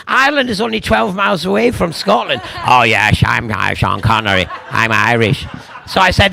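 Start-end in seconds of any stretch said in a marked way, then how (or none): 2.7-5.5 low, emphatic Scottish star voice; mock Scottish film star, pitched down; unchanged deep Scottish impression, played for laughs; Sean Connery. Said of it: "we had to play an Irishman".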